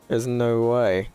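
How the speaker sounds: normal talking voice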